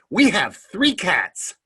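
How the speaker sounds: high, loud, slow mock-child voice; little boy's announcement. Little boy off to the side, imitated slow blurt. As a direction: imitate a small boy proudly announcing news.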